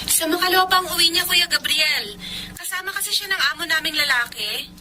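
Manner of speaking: soft, thin telephone voice; voice on the phone. Acted. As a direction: speak from the far end of a call. Heard from the other phone end. (boses sa kabilang linya ng telepono)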